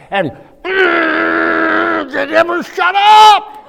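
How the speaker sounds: loud, slow, high comic cry; exasperated wail. Retold or imitated. Complaining to the audience about the chatty girl, mock wail. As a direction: howl it in mock despair.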